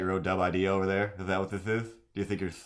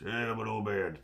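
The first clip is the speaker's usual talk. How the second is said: lightly voiced old man; 4 dB quieter than usual. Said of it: "It's not a good old man voice".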